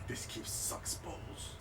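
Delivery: unvoiced, slow muttered whisper; whispered grumble. Noble whispering his gripe about the keep as he walks off, unvoiced. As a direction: grumble it under the breath while leaving.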